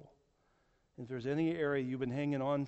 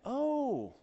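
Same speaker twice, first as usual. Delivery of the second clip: high, drawn-out mock "oh"; retold shock, voice pitched way up; 10 semitones higher than usual. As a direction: imitate a taken-aback listener, pitch swooping down from high. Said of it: "they were real shocked at that".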